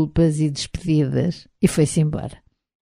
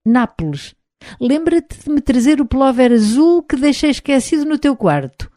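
higher-pitched narrated jeering shout; calling out; 8 semitones higher, 3 dB louder than usual. A narrator's reading. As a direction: call it out across a café, pitched up. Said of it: "um dos meus cortejadores rejeitados me gritou".

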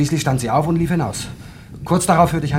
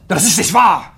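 outraged denial; 7 semitones higher, 9 dB louder than usual. Acted. Accused of murder, denies it.

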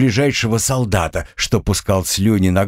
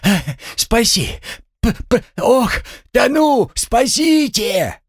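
high panicked screams for help; drowning panic; slower than usual. A narrator's reading. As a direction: yell as if drowning. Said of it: "завопил по-другому". (панический вопль тонущего)

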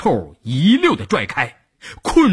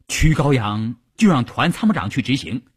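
ordinary delivery

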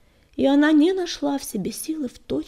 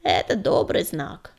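put-on lower, slow old granny voice; old she-cat rumbling; 6 semitones lower than usual. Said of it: "заурчала старая кривуля".

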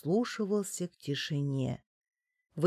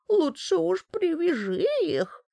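toothless mumble; 10 semitones higher than usual. A narrator's reading. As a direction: mumble fearfully like a toothless old hag. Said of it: "дрожащим голосом прошамкала старушонка". (шамкающий дрожащий старушечий голос)

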